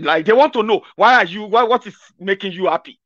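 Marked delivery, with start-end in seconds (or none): none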